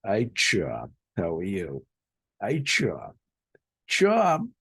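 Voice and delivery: Boston "Hi, Chum" with teeth together; boston accent. Retold or imitated. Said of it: "what they say is".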